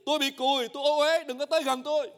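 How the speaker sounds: loud leper's warning cry. Acted. Leper warning passers-by, loud cry. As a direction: call out to keep people away. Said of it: "phải la lên liền".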